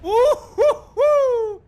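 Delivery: very high, loud whooping hoots; excited whoop. Real, not acted. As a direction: whoop with delight at the explosion. A big hit lands.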